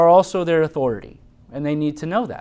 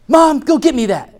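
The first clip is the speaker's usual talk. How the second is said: put-on bossy child; 11 semitones higher, 8 dB louder than usual. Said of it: "if they just say".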